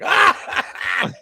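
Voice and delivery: high, loud yelp; imitated outburst. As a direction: imitate a commentator's sudden shriek.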